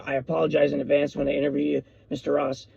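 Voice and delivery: usual speaking voice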